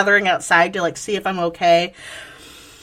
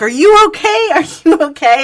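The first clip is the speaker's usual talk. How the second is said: high, louder alarmed "are you okay"; worried calling out; 9 semitones higher, 6 dB louder than usual. Retold or imitated. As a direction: call out to her, alarmed. Concerned strangers calling out.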